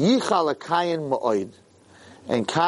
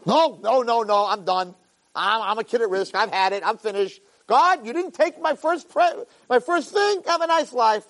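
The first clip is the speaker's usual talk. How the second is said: put-on higher, whiny kid who gives up; sulking Cain; 7 semitones higher than usual. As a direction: throw up your hands and quit. Cain giving up in a huff.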